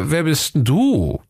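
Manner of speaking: slow, mystified inspector; puzzled. A narrator's reading. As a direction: ask in bewildered wonder, slowly. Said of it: "fragte verwundert".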